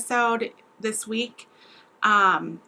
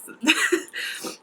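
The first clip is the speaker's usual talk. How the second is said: breathy laugh; 5 semitones higher than usual. Real, not acted. Laughing while wrapping up.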